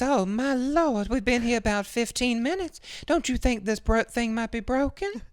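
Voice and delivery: very high, slow, worried put-on voice; fretful old lady. Missus Burns stuck at the red light, high and fretting. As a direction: fret high and drawn out. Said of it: "She says".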